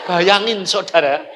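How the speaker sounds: ordinary delivery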